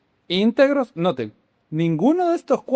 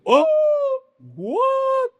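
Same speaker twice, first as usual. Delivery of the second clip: high, drawn-out mock gasp of amazement; astonished crowd. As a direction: imitate everyone stunned, pitch shooting way up. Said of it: "Todo el mundo se quedó así".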